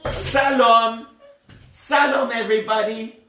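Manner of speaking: "Salom" with a plain s for shalom. Shown as a demonstration. Storyteller mimics the river people's greeting, "Salom" with s for sh. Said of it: "do you know what they would say".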